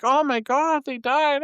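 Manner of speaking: high, hammed-up movie grief; overdone high-pitched dismay; mock grieving. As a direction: wail it in mock distress. Mimicking usual movie grieving reaction.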